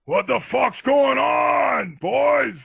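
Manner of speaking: slow, high, loud bellow of a woken dad; angry dad. Retold or imitated. Dad in underwear yelling at his sons in the kitchen, slow high bellow. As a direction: roar it like a dad roused from bed. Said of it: "and he goes".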